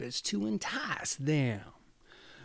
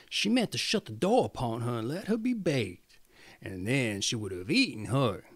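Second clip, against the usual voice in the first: ordinary delivery, nothing marked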